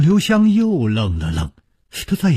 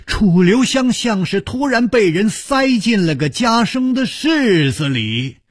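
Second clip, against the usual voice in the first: plain reading voice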